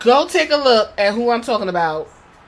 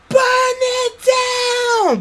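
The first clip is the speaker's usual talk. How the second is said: shrieked high catchphrase; high excited yell; high mock shout; 14 semitones higher, slower than usual. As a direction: scream it way up high like a fan. Chanting a wrestler's catchphrase.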